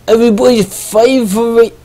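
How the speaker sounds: high hyped ring announcer. Acted. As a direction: sell it like a fight announcer.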